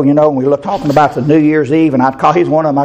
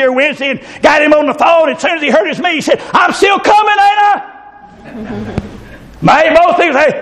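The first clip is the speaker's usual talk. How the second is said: preaching at full force; 14 semitones higher than usual. Acted. Preacher shouting the phone story to the church. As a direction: tell it out in a shout.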